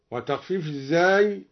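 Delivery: ordinary talk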